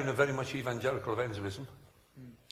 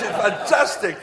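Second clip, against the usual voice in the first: ordinary delivery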